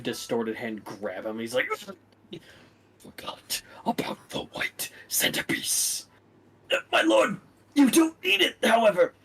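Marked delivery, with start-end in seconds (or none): 3.0-6.1 slow, breathy whisper; a menacing, hissing question; dark lord whisper. Acted. Dark lord questions his servant in a slow whisper. 6.7-9.1 high, strained, panicked; choked servant. Acted. Servant grabbed by the neck, pleading in a high strained voice.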